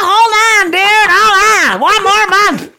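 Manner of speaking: high, loud begging stall; high pleading voice. Acted. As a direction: beg for time, high and loud. [name] pleading for time.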